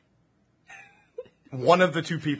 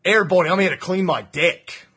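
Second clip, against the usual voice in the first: put-on loud, higher comic New Zealand accent; 6 semitones higher, 7 dB louder than usual. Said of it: "YouTube skit about an Australian deck cleaner".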